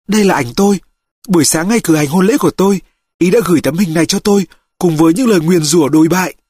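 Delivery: high-pitched woman's voice, resentful; bitter, indignant mother. Acted. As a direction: play the aggrieved mother, pitched well up. (giọng người mẹ cao, đầy cay đắng)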